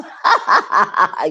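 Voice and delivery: short bright laugh at a chat joke; laughing at a viewer's joke in the chat; real laugh. Real, not acted. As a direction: laugh out loud at the comment.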